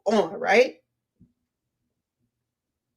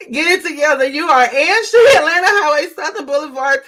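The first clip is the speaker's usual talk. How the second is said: loud, raised voice bubbling with real laughter; laughing; 4 semitones higher, 7 dB louder than usual. Real, not acted. Streamer rereads a viewer's comment on Montgomery streets, loudly laughing. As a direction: belt it out through the laughs. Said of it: "I'm laughing at that".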